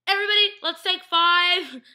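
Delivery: high, very loud mock yell; shouted impression of calling a break; imitated yell. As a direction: yell it out to the whole room, imitating her. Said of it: "She's, like, yelling".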